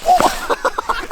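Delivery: sudden high cry as he falls; startled exclamation; surprised yelp. Real, not acted. Slips and falls on ice.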